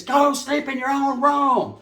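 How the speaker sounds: high, slow nagging mom voice; mock mother screaming. Mocking women who scream at their kids, high slow drawn scold. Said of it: "screaming at their kids".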